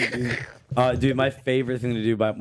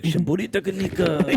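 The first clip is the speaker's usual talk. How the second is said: mock Japanese; 7 semitones higher than usual. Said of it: "I was like".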